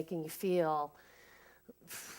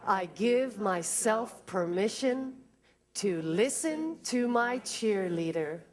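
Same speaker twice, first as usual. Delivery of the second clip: slow affirmation; 3 semitones higher, slower than usual. Shown as a demonstration. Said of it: "say that with me twice".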